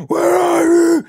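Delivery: roaring, high-pitched shout; screaming intruder. Retold or imitated. Huge man hunting a girl through the house, screaming. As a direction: bellow it out, stretched and loud. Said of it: "he's just screaming".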